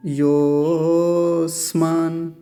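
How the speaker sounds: योस्मान with the "yo" held for about three beats; slow, chanted योस्मान on a sustained o; prolonged pluta vowel. Shown as a demonstration. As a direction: draw out the o of योस्मान very long, then "smaan". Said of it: "तो इसका उच्चारण कैसे होगा".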